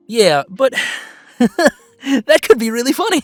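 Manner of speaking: loud, high, breaking into laughter; giggly and delighted; amused laughing. Acted. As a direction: laugh through the words, tickled by the idea. Amused by own idea.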